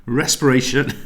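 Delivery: laughing at a stumbled word; flustered laugh. Real, not acted. Teacher laughs at her own tongue-twist, flustered. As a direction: giggle through the slip. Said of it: "my words literally will not come out".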